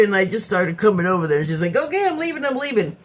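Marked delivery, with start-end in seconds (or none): 1.8-3.0 mimicked flustered giving-in. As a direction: imitate a guest hurrying to give in.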